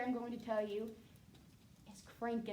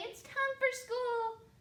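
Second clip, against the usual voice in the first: sing-song reminder; 12 semitones higher, 5 dB louder, slower than usual. Retold or imitated. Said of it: "You would also say".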